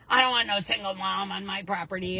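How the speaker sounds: put-on loud, gruff old rancher; old coot. Ranch owner turning away a single mom, loud and gruff. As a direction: bark it like a cranky old coot. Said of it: "he was an old coot".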